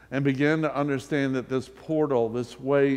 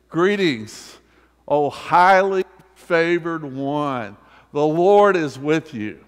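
louder, building to a ringing call; grand proclamation; 3 semitones higher, 8 dB louder, slower than usual. Acted. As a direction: announce it to the whole room with full force. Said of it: "and saying to you and me".

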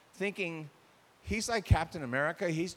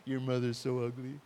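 sweet tone on rude words; 4 semitones lower than usual. Shown as a demonstration. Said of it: "wrong words with the right tone of voice".

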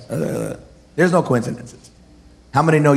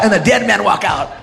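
ordinary delivery

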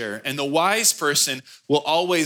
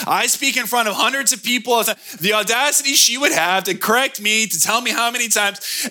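indignant rant; 5 semitones higher than usual. Retold or imitated. Pastor venting to wife Kristen in the car, indignant fast rant. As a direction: rattle off the outrage to your wife.

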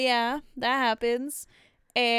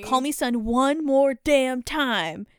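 slow, louder retort as Hamilton; mock indignation; 2 semitones higher, 5 dB louder, slower than usual. Hamilton retelling his retort to Washington, mock indignant. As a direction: push back slowly, hammed-up anger.

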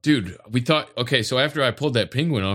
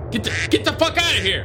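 shooing yell; 9 semitones higher than usual. Real, not acted. Shooing penguins away.

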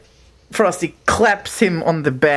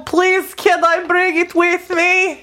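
higher, calling up to the ceiling; Frosty calling; 10 semitones higher than usual. Acted. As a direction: creature shouting at the magic voice above.

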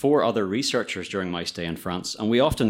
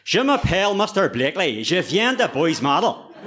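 put-on old French teacher; 6 semitones higher, 4 dB louder than usual. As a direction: mimic your old French teacher introducing himself. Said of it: "the voice of my old French teacher".

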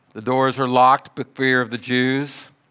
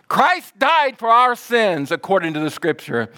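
gospel proclamation; 8 semitones higher, 4 dB louder than usual. Real, not acted. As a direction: proclaim each word with force.

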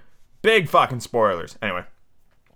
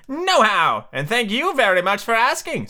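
put-on Tweedle boy voice; 8 semitones higher than usual. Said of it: "said Tweedledum".

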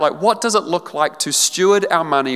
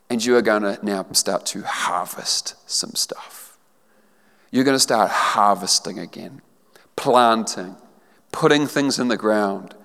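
gentle assurance; 5 semitones lower than usual. Real, not acted. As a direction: speak it like a tender promise. Gentle promise of a new season.

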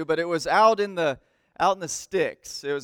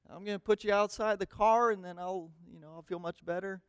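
muttered parental threat; 9 dB quieter than usual. Acted. Parent's threat to nagging kids.

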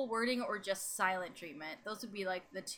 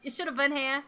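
higher put-on British "here"; mock British; 5 semitones higher than usual. Teasing absent David, mock British. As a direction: tease in a dodgy British accent. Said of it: "I don't know any British insults".

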